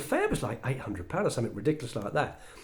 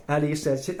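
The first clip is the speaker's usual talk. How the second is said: ordinary talk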